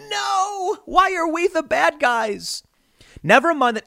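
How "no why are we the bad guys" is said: very high, slow whiny parody; mock whine. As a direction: whimper it like a hurt villain. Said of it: "watching woke entertainment go".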